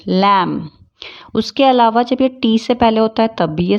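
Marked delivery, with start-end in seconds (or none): 0.1-0.7 लैम ending on m; लैम with no b after m; silent B. Shown as a demonstration. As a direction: say लैम as lam. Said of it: "बी कब कब silent रहता है".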